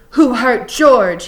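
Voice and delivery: slow, loud, high-pitched challenge; angry demand. A narrator's reading. Lenny advancing on Crooks, demanding angrily. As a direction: demand it, drawing out each word. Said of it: "he demanded".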